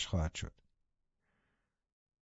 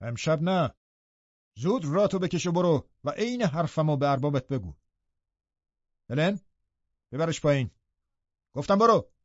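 raised pitch, commanding dismissal; very firm; 4 semitones higher than usual. A narrator's reading. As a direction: refuse flatly and order him out. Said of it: "خیلی محکم جواب داد".

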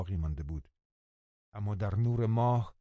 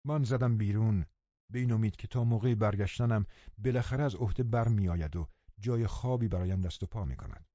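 usual speaking voice

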